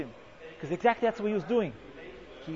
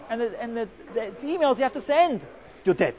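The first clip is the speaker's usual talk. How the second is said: ordinary delivery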